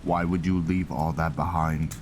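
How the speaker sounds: ordinary talk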